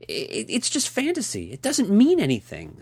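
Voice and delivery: high, stammering excuse; flustered stammering excuse; pitiful excuse. A narrator's reading. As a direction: plead weakly, caught out. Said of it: "as pitiful excuse".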